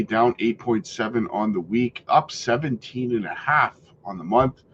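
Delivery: ordinary talk, nothing marked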